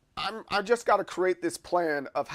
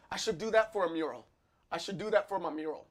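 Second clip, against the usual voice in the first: normal talking voice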